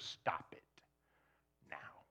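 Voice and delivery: soft, unvoiced stern command; quiet firm command. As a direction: say it low and final. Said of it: "His answer is".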